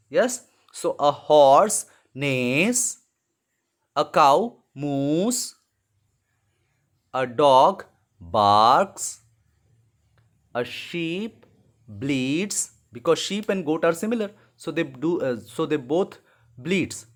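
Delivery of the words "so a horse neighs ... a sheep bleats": very slow, loud and clear; slow dictation. Shown as a demonstration. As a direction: dictate slowly to the class. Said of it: "putting the sounds in the blank".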